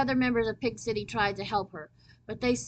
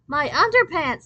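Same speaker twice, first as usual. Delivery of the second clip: loud, high shocked scream; 7 semitones higher, 9 dB louder than usual. Acted. Kristen sees her underpants on the flag, screaming. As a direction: shriek it in horror. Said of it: "screamed Kristen".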